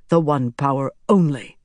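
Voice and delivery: plain reading voice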